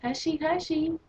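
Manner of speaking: high-pitched mock teacher hushing; teacher shushing. Mimicking the Spanish teacher quieting fifty-six students, high-pitched shushing. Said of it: "she just wants to say".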